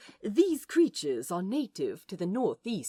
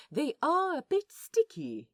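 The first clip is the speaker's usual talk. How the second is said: ordinary delivery, nothing marked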